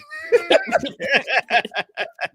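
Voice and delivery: long high cackle of laughter; storyteller laughing after the punchline. Real, not acted. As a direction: break up laughing.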